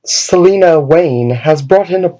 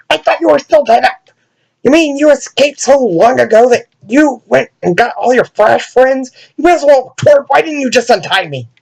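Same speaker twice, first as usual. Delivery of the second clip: exasperated; 10 semitones higher than usual. Acted. Tied-up Damian scolding friends.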